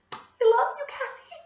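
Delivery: very high, swooning declaration; emotional outburst. A narrator's reading. Teacher tearfully telling a student she loves her, very high. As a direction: gush with love.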